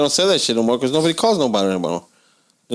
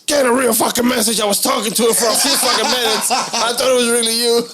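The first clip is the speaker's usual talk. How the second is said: mock angry boss; 11 semitones higher than usual. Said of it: "my boss called me one time".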